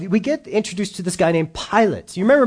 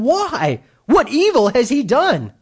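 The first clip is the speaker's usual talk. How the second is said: pleading Pilate; 7 semitones higher, slower than usual. Retold or imitated. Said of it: "And Pilate said to them".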